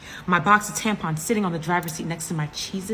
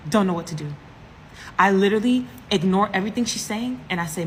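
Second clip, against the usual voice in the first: ordinary talk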